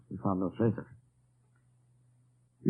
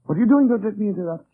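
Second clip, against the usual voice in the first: sudden, much higher and louder outburst; surprised exclamation; 11 semitones higher, 8 dB louder, faster than usual. Acted. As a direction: catch him in the act, loudly. Commandant catches him sewing the button.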